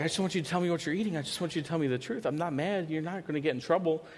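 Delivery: pleading reassurance. Retold or imitated. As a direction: coax the truth out of her quickly. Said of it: "I was like".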